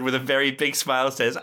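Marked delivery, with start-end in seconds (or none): none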